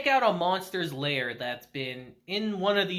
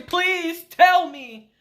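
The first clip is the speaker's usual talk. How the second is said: slow, high, louder begging; last desperate please, much slower; 8 semitones higher, 4 dB louder, much slower than usual. Retold or imitated. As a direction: drag it out, begging. Uncle pleads with hedgehog.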